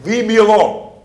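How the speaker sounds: high, loud cry to be left alone; demon's loud cry. Acted. Preacher voicing the demon reacting to Jesus, loud high cry. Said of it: "And so he's saying".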